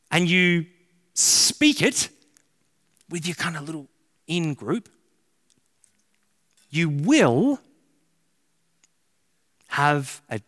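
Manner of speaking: normal talking voice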